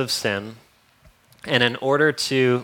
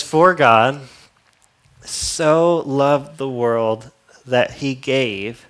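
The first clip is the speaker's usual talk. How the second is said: "For God" loud, then very slow; slow scripture read; 6 dB louder, much slower than usual. Real, not acted. Preacher reading the famous verse, slow and loud. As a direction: proclaim it slowly, word by word.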